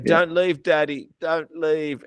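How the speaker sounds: mock-pleading little girl begging dad to stay; pleading child. Father quoting crying daughter at the door, a mild pleading child. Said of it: "And she'd go".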